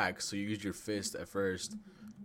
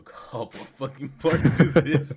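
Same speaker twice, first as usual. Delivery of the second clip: laughing loudly; 6 semitones higher, 16 dB louder, slower than usual. Real, not acted. Laughing about podcast length.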